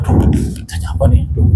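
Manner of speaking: put-on a child fretting under its breath; nervous whisper. Child anxious over father's call, whispering nervously. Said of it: "anak ... udah deg-degan".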